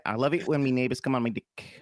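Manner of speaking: Kiwi "me" for my, "dick" for deck; New Zealand accent. Shown as a demonstration. Host correcting the co-host, New Zealand accent. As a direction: model it in mock New Zealand. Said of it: "You just say".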